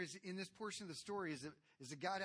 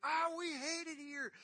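high, loud whiny moan of fed-up people; mock wailing complaint; 12 semitones higher, 7 dB louder than usual. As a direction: whine it like a grumbling crowd.

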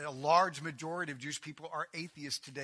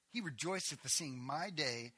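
normal talking voice

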